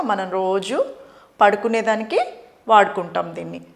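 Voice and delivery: ordinary talk, nothing marked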